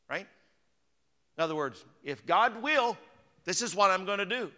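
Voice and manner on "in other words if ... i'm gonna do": emphatic. Acted. Preacher builds emphasis.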